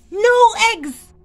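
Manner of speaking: high, loud outraged yell; desperate cry. Acted. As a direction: throw the words up in outrage. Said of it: "cried Farmer Brown".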